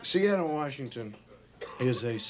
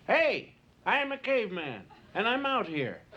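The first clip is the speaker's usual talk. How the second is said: caveman; 8 semitones higher than usual. Acted. Caveman voiced in a joke.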